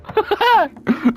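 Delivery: high, mocking cartoon giggle; high teasing cackle; squeaky cackle. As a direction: cackle high like a gleeful imp. Laugh after taunt.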